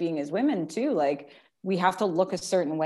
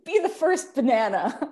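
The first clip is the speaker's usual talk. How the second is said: higher, louder giddy squeak; playful minion voice; 5 semitones higher, 5 dB louder than usual. Pretend. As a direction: play a tiny cartoon henchman. Echoing the minion bit playfully.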